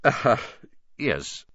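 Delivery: halting, painfully nice; awkward, hesitant coaxing; forced pleasantness. A narrator's reading. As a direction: feign friendliness through a forced smile. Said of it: "forced his face into a smile".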